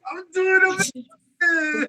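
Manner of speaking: very high, drawn-out excited squeal; overjoyed. Real, not acted. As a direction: stretch it out high with delight. Greeting the special guest, overjoyed.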